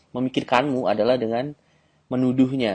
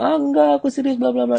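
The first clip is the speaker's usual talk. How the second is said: put-on much higher, protesting girl's voice; mock girl protesting; 4 dB louder than usual. Shown as a demonstration. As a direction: squeak out her denial like the girl.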